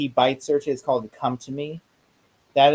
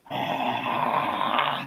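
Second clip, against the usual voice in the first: vampire hiss; 5 dB quieter than usual. Retold or imitated. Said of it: "then Jerry just goes".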